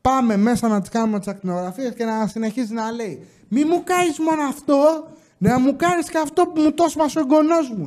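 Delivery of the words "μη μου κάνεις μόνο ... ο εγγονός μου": put-on high, insistent old woman's plea; imitating grandma. Grandma insisting to the doctor, high and pleading. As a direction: pitch it up like a stubborn grandma.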